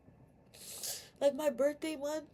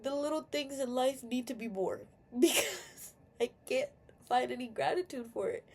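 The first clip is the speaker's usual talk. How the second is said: ordinary talk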